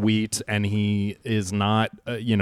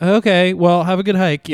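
higher and louder dismissive goodbye; high, loud woman voice; 8 semitones higher, 6 dB louder than usual. Retold or imitated. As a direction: cheerfully brush them off, up high.

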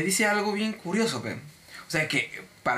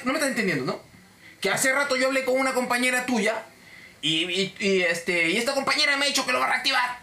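overbearing complaint, pitched up; arrogant; 6 semitones higher than usual. Retold or imitated. Cliente que reclama que le reactiven, prepotente. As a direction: press the point like an entitled customer. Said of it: "la voz, este, prepotente".

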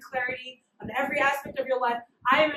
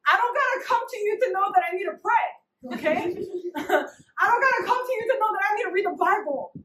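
frustrated; 6 semitones higher than usual. Retold or imitated. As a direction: vent at the pastor, fed up. Frustrated complaint to pastor.

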